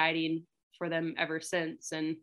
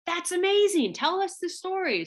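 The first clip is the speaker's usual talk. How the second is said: much higher, wide-eyed amazement; impressed guides; 13 semitones higher, 4 dB louder than usual. Retold or imitated. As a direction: gush like you can't believe it. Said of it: "And they're like".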